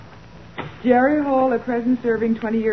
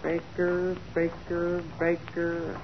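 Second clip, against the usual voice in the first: soft, low repeated murmur; searching mutter; 4 semitones lower, 9 dB quieter, slower than usual. Acted. Assistant scanning files for a suspect, muttering low. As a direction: mumble the name to yourself while hunting.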